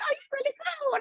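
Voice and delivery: pleasantly surprised, high and light; high, soft imitation of a won-over peer; impressed peer. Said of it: "they're like".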